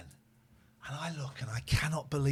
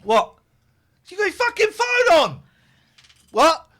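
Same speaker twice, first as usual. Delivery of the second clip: much higher, louder mock yell; retold shouting at driver; 13 semitones higher, 10 dB louder than usual. Driver tells wife how she yelled at a van, loud mock yell. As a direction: bark it across the traffic. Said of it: "I went".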